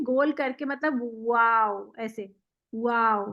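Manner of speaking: वाव said slowly with the lips rounded, English w style; rounded waw. Shown as a demonstration. Teacher showing her student the lip-rounded Arabic waw, slow. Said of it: "वो होंठ गोल करते हैं".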